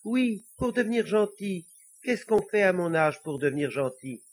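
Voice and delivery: high-pitched, polite little devil voice. Acted. Little devil asking the priest how to be good, high and polite. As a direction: plead politely as a small devil.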